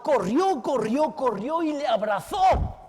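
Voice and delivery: rising with each "corrió", last one shouted; excited telling. A narrator's reading. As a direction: build the excitement with every "corrió". Jacob corre hacia el ángel. ("corrió" cada vez más alto, el último gritado)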